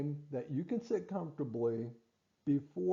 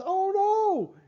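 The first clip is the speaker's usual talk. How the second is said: much higher, louder mock squeal of "Oh no"; brain panicking; 9 dB louder than usual. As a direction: voice the brain's sudden alarm. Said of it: "as soon as your brain says".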